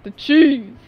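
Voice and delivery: deep voice. Pretend. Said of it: "Oh, I'm sorry".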